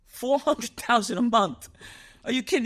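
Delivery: high, drawn-out disbelief; incredulous. Real, not acted. Man appalled his rich associate wouldn't pay his lawyer, incredulous. Disbelief at a huge monthly income.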